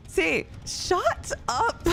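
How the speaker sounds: high, very slow "shut up" in a put-on voice; mock exasperated. Streamer mock-snaps at her dramatic friend Saint, high and slow. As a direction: drag it out in playful disgust. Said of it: "I'm like".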